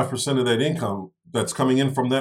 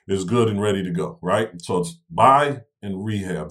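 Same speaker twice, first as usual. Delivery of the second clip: ordinary talk, nothing marked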